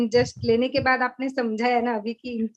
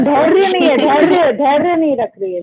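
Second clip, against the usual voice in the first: eager loud answer; 4 semitones higher, 11 dB louder than usual. Real, not acted. Student eagerly shouts answer, laughing.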